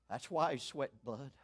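normal talking voice

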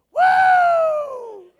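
long high loud woo; whoop. Retold or imitated. Fella at the revival meeting, a joyous loud whoop. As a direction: let out a big sustained whoop. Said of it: "he said (shout)".

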